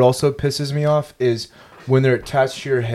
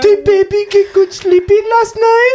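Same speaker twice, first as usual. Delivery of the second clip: put-on high cooing baby talk; baby-talk a sleepy partner; cutesy baby voice; 4 dB louder than usual.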